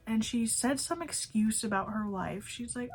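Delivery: ordinary talk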